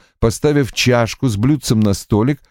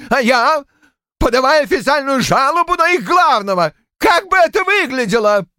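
high furious shouting. A narrator's reading. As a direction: rant at her, beside himself. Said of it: "вскричал".

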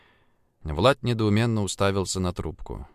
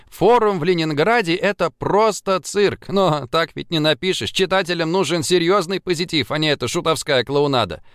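put-on chattering young woman; 7 semitones higher, 3 dB louder than usual. A narrator's reading. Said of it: "трещала, как сорока".

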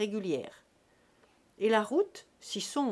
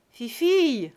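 louder, higher call across the room; 5 semitones higher, 9 dB louder than usual. A narrator's reading. Drunk father calling his daughter across the dance. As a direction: call her over, loud. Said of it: "appela Jésus-Christ".

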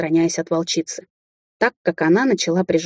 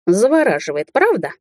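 lilting taunt, lifting high on the question; singsong; 3 semitones higher than usual. A narrator's reading. Witch advances on frightened rival, singsong menace. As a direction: purr it out in a mild singsong. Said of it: "пропела я".

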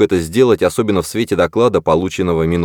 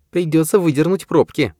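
unruffled, higher female voice; calm woman's voice; 10 semitones higher than usual. A narrator's reading. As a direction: keep it cool and level, higher. Washburn continues, high voice.

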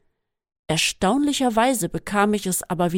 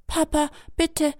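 pleading; 8 semitones higher than usual. A narrator's reading. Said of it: "flehte ich".

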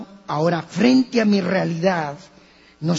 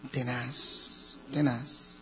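hushed grave murmur; 4 semitones lower, 11 dB quieter, much slower than usual. Real, not acted. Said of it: "me di cuenta del problema".

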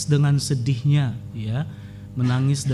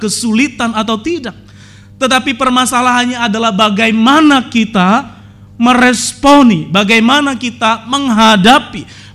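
loud, higher, fervent sermon voice; full-force preaching; 8 semitones higher, 8 dB louder than usual. Acted. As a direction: drive the point home to the congregation. (khotbah yang berapi-api dan lantang)

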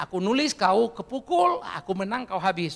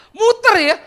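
much higher and louder exclamation; 13 semitones higher, 13 dB louder, slower than usual. Real, not acted. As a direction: burst out in amazement. Preacher exclaims about Paul. (seruan keras dan tinggi, takjub)

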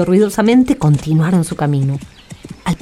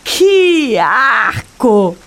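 drawn-out disgusted groan; 4 semitones higher, much slower than usual. Acted. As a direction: recoil from the mud. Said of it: "exclamaron los hermanos".

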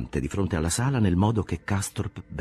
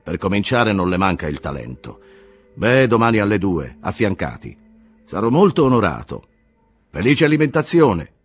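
booming, higher jovial voice; hearty doctor, louder; 3 semitones higher, 7 dB louder than usual. A narrator's reading. Behrens heartily agrees to examine the cousin, booming. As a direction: play the jolly doctor, louder and up. Behrens jokes about the fever.

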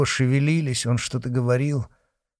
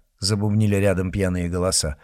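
ordinary delivery

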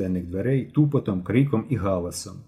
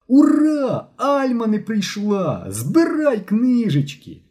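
high, stretched-out cheering; jubilant shout; 12 semitones higher, 4 dB louder, much slower than usual. A narrator's reading. Boy announces the teacher did not come, jubilant shouting. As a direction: yell the good news to the whole class. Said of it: "вбіг у клас і закричав".

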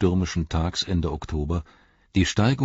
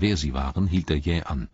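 usual speaking voice

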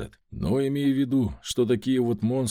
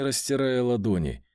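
ordinary delivery

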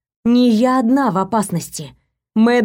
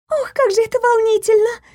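high squeak of excitement; squeaky; 14 semitones higher than usual. Acted. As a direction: squeal it like an excited young girl. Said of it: "пискнула у меня за спиной Адель".

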